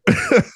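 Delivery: sudden high-pitched giggle; high burst of laughter. Real, not acted. Goalie laughing about a teammate's hard shot, high giggle. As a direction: break into a high laugh at yourself. Laughing at own cocky story.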